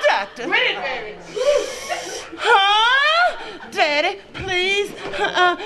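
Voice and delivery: squealing high, laughing pleasure moans; a woman on the exam table eggs the doctor on in high, laughing moans; hammed-up moaning. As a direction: ham up a woman getting carried away on the table.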